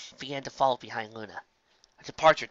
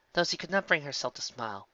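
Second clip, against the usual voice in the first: ordinary delivery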